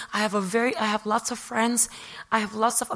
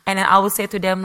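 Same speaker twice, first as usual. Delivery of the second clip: usual speaking voice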